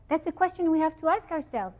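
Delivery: usual speaking voice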